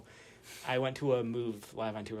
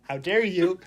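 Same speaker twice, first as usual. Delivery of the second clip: higher, much louder how dare you; mock outrage; 7 semitones higher, 13 dB louder, slower than usual. Friend joining the joke about the mullet, loud mock outrage. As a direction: play up being scandalised.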